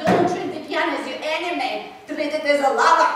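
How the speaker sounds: imitating an emphatic Russian piano teacher's accent; thick Russian accent. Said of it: "with a thick Russian accent".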